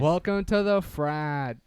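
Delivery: slow, loud bro greeting; frat bro welcome. Acted. Hosting intro, slow and loud.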